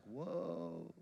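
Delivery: hushed, drawn-out mock awe; awed whoa. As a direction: softly imitate an impressed crowd. Crowd awed as he passes.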